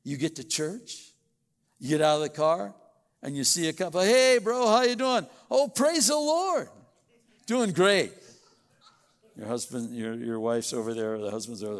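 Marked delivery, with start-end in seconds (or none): 4.0-8.1 loud, high, beaming put-on cheer; fake cheerful greeting. Couple greeting each other at church, loud fake cheer. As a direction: gush like everything is wonderful.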